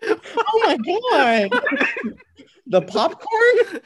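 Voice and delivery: high, drawn-out disbelief through laughter; incredulous laughing. Real, not acted. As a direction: crack up in loud, slow disbelief. Laughing in disbelief at the popcorn name.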